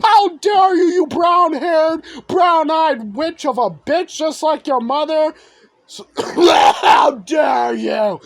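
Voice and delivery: high, loud enraged yelling; the wounded villain roaring at the girl; furious scream. Acted. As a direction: scream it at her with an eye slashed. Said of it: "He screamed".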